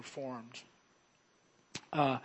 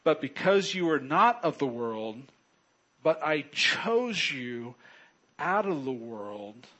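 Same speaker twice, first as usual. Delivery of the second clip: louder, measured Bible reading to the church; scripture reading; 5 dB louder than usual. Acted. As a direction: read the verse out with weight, slowing on "chose". Reads scripture aloud to church.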